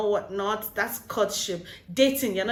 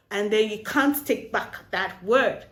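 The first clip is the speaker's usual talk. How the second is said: ordinary delivery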